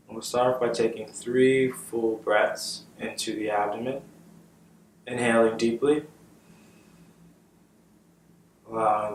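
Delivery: calm, unhurried meditation guide with long pauses; measured, settled voice for leading meditation; guided meditation. Real, not acted. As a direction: guide them gently into the breath, leaving space after each phrase.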